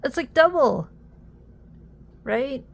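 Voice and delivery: loud, high astonishment; amazed exclaim. Real, not acted. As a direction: say it like you can't believe it. Amazed at weight.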